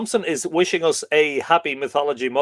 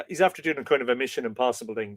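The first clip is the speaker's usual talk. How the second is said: normal talking voice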